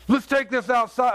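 heated challenge, imitated; retold angry threat. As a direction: act out a mad man picking a fight. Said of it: "Daryl gets really mad".

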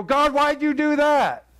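high, drawn-out whine at God; whiny complaint. Retold or imitated. Believer whining to God after punishment, high whine. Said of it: "And you go".

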